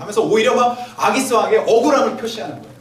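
ordinary talk, nothing marked

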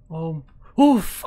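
loud shocked oof; startled yelp. Real, not acted. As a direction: jump and yelp in fright. Jump scare by bird.

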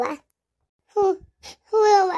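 drawn-out, crying yes; tearful assent. Acted. Bride answering her mother's advice, tearful. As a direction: agree through sobs.

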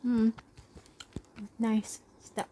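talking with food in mouth; chewing. Real, not acted. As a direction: mouth partly full. Seen 0: Bit puff at -3; lips closed, jaw working through "hmm, nice.

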